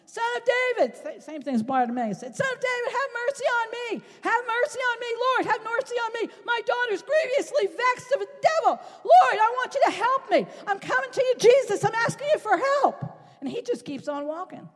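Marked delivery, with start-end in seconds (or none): 0.0-1.0 hollering. Acted. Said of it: "she starts hollering at him". 2.4-13.0 high, loud pleading cry; a desperate mother hollering after Jesus; hollering woman. Acted. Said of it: "she starts hollering at him".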